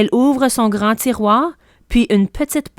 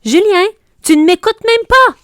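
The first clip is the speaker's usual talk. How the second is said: high, loud exasperated cry; a mother crying out at her distracted son, high and loud; mother exclaims; 11 semitones higher, 5 dB louder than usual. A narrator's reading. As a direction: call him back sharply, high and loud. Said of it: "s'écrie maman".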